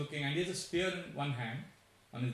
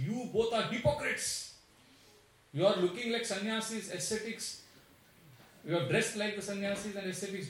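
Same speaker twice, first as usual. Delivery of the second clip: lightly acted accusing demon, higher pitched; indignant demon; 6 semitones higher than usual. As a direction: hurl the charge in a raised, high voice. Demon berates Ram and Lakshman.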